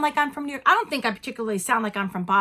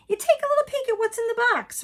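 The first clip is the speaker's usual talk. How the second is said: coy high voice; 11 semitones higher than usual. Acted. Playful peek, high put-on voice.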